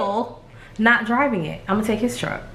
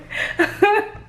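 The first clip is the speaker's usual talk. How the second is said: high burst of laughter; 12 semitones higher than usual. Real, not acted. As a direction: laugh at yourself. Laughing at own look.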